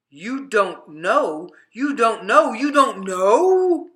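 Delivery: can't believe what they heard; disbelief rising. Acted. People repeat in disbelief.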